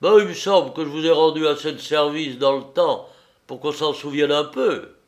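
voix de marin plus forte et haute; marin bourru. A narrator's reading. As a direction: rappelle ses services avec rudesse. Le marin réclame son dû. (marin bourru qui réclame)

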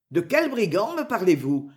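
ordinary delivery, nothing marked

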